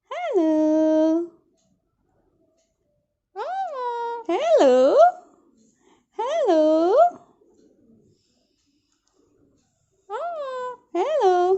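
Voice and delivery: put-on high, loud, drawn-out baby hello; sing-song hello. Shown as a demonstration. Mother coaxing her baby to say hello, in a high sing-song. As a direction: call hello high and bright to coax the baby. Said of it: "hello बोल दो baby".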